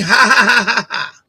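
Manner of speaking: loud, forced ha-ha-ha; mocking fake laugh. Streamer mimicking a chatter's typed laughter, mocking. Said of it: "you know, you keep writing".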